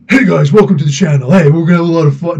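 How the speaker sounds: hearty mock channel intro; Rob. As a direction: imitate an excitable YouTuber greeting viewers. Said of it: "see if I can do Rob".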